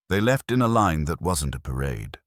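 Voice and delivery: ordinary delivery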